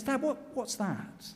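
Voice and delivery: high, soft, slow question; small hesitant voice asking a parent; uneasy child. Acted. Child spots dust cloud, uneasy.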